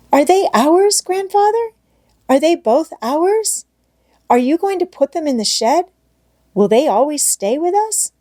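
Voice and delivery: put-on high eager child voice, rising questions; a delighted girl pelting grandfather with questions; excited child questions. A narrator's reading. Heidi's questions tumbling out.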